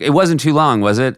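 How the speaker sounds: fishing for reassurance. Retold or imitated. Comedian asking audience friends backstage after his show, lightly mock-needy. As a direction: ask as if hoping for a no.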